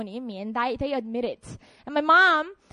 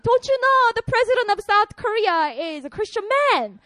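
uncle lecturing; 8 semitones higher, 5 dB louder than usual. Retold or imitated. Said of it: "what he was saying is".

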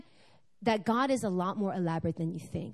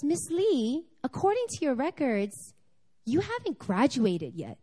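higher, prim official voice; formal office lady; 5 semitones higher than usual. Retold or imitated. Office lady telling the student she hasn't graduated, prim and official. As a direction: deliver bad news in a prim official tone. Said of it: "She goes".

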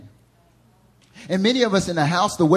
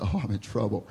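dread under the breath; 7 semitones lower, 8 dB quieter than usual. Retold or imitated. Child realizing he's caught.

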